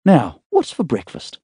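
a hint of a groggy young woman just waking; young woman's voice. A narrator's reading. Emma voiced, higher.

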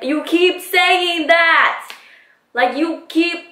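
high, drawn-out retold complaint; exasperated, slow and high-pitched. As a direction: drag each word out in rising frustration. Retold exasperation at his denials.

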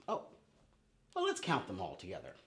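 usual speaking voice

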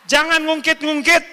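loud, high prohibition; emphatic command. Real, not acted. Preacher forbidding husbands to dredge up old faults, loud and high. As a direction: stamp the ban down hard.

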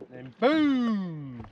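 ordinary talk, nothing marked

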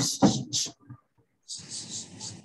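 unvoiced "s-" hiss; hissed s sound. Shown as a demonstration. Tutor showing friend the final s, hissed softly. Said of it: "thêm cái s".

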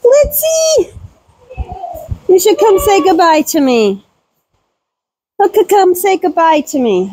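high sing-song call; calling sweetly. Real, not acted. Calling goat Blitzy over to say goodbye, high coaxing.

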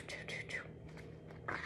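whispered slow footstep choo; hushed slow whisper; soft whisper. Pretend. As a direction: breathe it out softly like shoes on a path. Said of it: "They make a soft sound".

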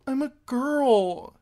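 put-on high, slow, sniffling girl. A narrator's reading. Girl confessing to Harwin, high slow sniffle. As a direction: sniff the words out tearfully. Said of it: "she sniffled".

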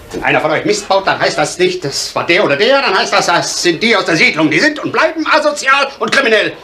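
rising indignation. Acted. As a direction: build from firm warning to high-pitched outrage. Bitter outburst about the estate's stigma.

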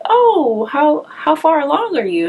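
a high, chirpy imitation of the clinic staff; lightly mock their curious question; mimicking nosy staff. She mimics clinic staff asking how far along, high and chirpy.

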